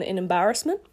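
usual speaking voice

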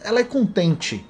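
high, fast, delighted voice; eager admirer. Retold or imitated. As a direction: gush like a charmed stranger. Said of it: "As pessoas vão".

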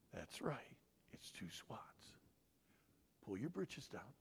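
hushed, low parent voice; walk the child through it softly and deliberately; methodical. Acted. Dad reviewing the offense with his child, soft and methodical. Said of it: "it's very methodical".

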